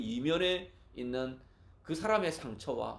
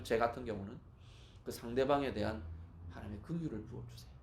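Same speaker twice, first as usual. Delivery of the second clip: ordinary talk, nothing marked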